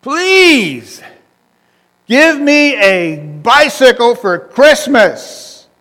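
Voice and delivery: high, loud, slow pleading yell; a kid bellowing up at heaven; child shouting his Christmas wish; boy yelling a prayer. Retold or imitated. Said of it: "crying, he's yelling".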